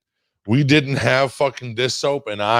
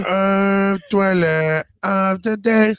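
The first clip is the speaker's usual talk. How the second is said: announcer voice; 8 semitones higher than usual. Acted. Echoing the announcer call.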